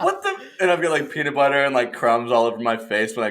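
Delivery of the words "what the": high-pitched shocked gasp; high startled what. Retold or imitated. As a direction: yelp it in surprise.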